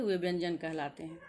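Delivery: ordinary talk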